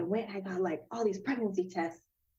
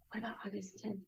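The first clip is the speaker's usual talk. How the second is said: soft unvoiced whisper to her husband; whispered question; 11 dB quieter, faster than usual. Quietly asking Jeremy about the date, whispered. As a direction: lean in and whisper it. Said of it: "look at Jeremy and I'm like".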